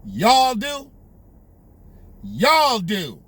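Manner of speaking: mock politician's pronouncement; drawn-out imitation of a politician's phrase; imitating Joe's delivery. Commentator repeating the politician's words, mimicking how he said it. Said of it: "do you see how he said".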